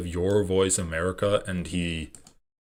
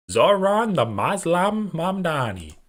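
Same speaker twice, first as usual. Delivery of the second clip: put-on higher, slow, drawn-out New York accent; mock New York accent; 8 semitones higher, 4 dB louder, slower than usual. Streamer mimicking the clip's New York accent, slow and high. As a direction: stretch the name out in a mocking New York accent. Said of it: "Is this a New York accent?".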